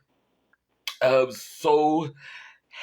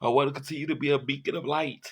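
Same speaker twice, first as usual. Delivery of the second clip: normal talking voice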